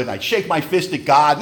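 normal talking voice

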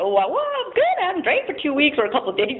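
mock breezy. Alcoholic answering how are you, in mock carefree cheer. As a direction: toss it off like nothing is wrong.

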